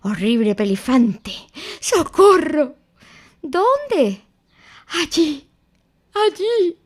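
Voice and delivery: high, loud panting cries; breathless fright. A narrator's reading. Piglet to Christopher Robin, breathless and scared. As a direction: gasp it out, pointing back.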